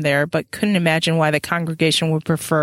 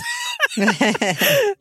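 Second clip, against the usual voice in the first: high shouted burst of laughter; shrieking laugh. Real, not acted. Podcaster howls with laughter at Isabeau's line. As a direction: howl with laughter.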